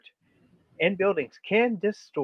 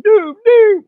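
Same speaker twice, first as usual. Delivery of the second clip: much higher, louder spooky doom; 14 dB louder than usual. Pretend. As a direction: wail it like an omen. Echoing impending doom for effect.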